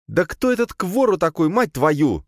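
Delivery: high, exasperated woman's outburst; angry growled demand. A narrator's reading. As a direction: demand an answer crossly, pitched high. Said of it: "зарычала на него Надя". (злой, высокий женский выпад)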